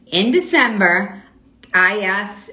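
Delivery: slow, spelled-out words for a viewer; slow it right down for them; speaking very clearly. Shown as a demonstration. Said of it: "I'll say it very clearly".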